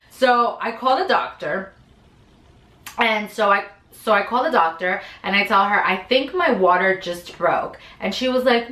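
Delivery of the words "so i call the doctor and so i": ordinary talk, nothing marked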